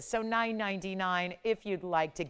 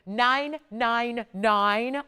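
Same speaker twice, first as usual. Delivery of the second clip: very slow and louder, each "Nine" stretched; drawn-out price; 4 semitones higher, 6 dB louder, much slower than usual. Acted. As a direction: spell out "Nine nine nine" slowly, leaning on every word.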